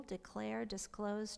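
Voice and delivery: normal talking voice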